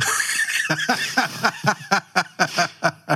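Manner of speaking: cracking up at his own punchline; big laugh. Real, not acted. Laughing at own boss joke.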